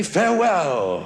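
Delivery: slow, grandly drawn out Farewell; a mock-noble parting word. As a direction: bid farewell like a knight of old. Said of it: "Now I say".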